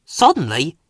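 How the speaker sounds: ordinary delivery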